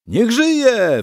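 very high, loud cheer; cheering yell. A narrator's reading. Tipsy nobleman yelling a cheer in the tavern. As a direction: yell it out in toast. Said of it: "wrzasnął inny szlachcic".

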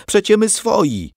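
high, loud protest; crying out. A narrator's reading. Aunt protests to their own troops, crying out. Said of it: "zawołała stryjenka".

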